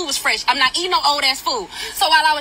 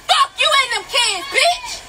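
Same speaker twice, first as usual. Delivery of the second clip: higher, voice breaking into a cry; cracking scream; 9 semitones higher, slower than usual. Real, not acted. Customer screaming at the worker, voice cracking with tears.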